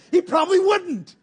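laughing. Real, not acted. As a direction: chuckle at your own joke. Joke about rude neighbor.

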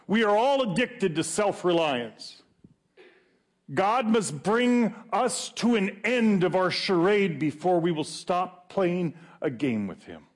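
high-pitched, driving preacher delivery; high, insistent pulpit question; impassioned preaching. Real, not acted.

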